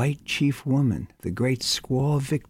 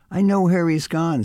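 higher, louder, knowing announcement; gleeful gossip, louder and higher; eager gossip; 6 semitones higher, 5 dB louder than usual. Acted. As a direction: blurt it out like hot news. Lenehan bursts in knowingly.

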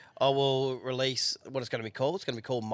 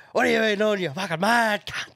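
higher, louder mock bloke greeting; port mates' greeting; 8 semitones higher, 5 dB louder than usual. Imitating the port blokes greeting a mate, high and loud. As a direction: hail a mate like the port boys. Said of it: "Remember the port".

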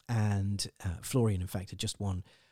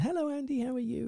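much higher sing-song greeting; lightly voiced Olivia saying hello; imitating Olivia; 13 semitones higher than usual. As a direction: chirp a bright hello.

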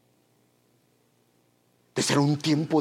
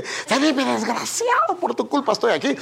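higher mock yell, laughing through it; woman in labour cursing husband; 8 semitones higher than usual. Preacher retelling what his wife heard from a woman giving birth, mock high cursing. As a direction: play the angry mother in labour, half laughing. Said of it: "así me contó mi esposa que decía a una mujer".

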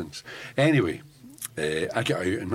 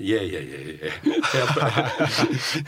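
quick offhand yeahs, half laughing; dismissive yeah yeah; much slower than usual. Retold or imitated. [name] brushing off the policeman's excuse, amused and dismissive. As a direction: wave the excuse away knowingly.

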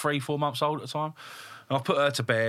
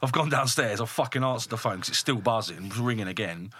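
ordinary delivery

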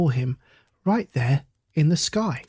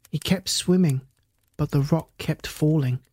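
plain reading voice

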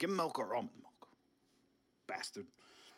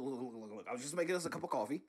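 usual speaking voice

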